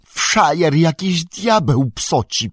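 high, loud and slow exclamation; alarmed outrage. A narrator's reading. Sam sees devilry in the Shire, alarmed outrage. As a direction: cry out in dismay, stretching the words.

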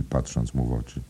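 usual speaking voice

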